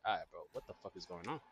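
fed-up demand. Retold or imitated. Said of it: "and I'm like".

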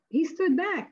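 normal talking voice

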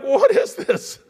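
staring at a tiny breakfast bowl, mock shock. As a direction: blurt it out in comic disbelief. Retold shock at small bowl.

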